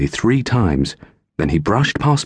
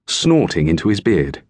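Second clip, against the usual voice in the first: plain reading voice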